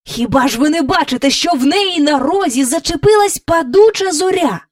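high, insistent, exasperated; angry. A narrator's reading. King turning on his courtiers in anger. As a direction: demand it heatedly, pitch climbing. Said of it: "Він у гніві обернувся до придворних".

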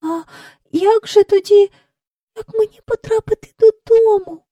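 put-on high-pitched, fearful child's question; anxious girl. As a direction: ask helplessly in a high, small voice. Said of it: "прошепотіла вона".

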